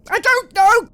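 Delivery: frantic man shouting he doesn't know; desperate yell. Acted. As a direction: yell it out of pure distress. Tormented man answers screaming.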